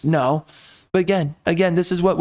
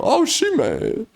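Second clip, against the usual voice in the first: mock sassy remark; 6 semitones higher, slower than usual. Reacting to the character's pose.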